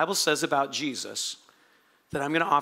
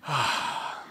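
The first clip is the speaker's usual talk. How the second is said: long, breath-only sigh; acted weary exhale; exhausted sigh; 4 semitones lower, 5 dB quieter than usual. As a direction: sigh out heavily, worn out. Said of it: "he's just straight exhausted".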